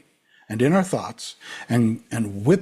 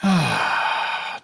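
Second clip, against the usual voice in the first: audible settling exhale; slow, releasing sigh; long sigh; 3 semitones higher than usual. Real, not acted. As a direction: breathe out fully to begin meditation. Settling into the meditation.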